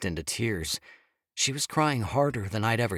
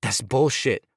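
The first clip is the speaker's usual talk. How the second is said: sharp, louder angry retort; spat out; 4 semitones higher, 6 dB louder than usual. Acted. Husband rejecting her words, spat out angrily. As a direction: spit the words back at her. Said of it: "I spat".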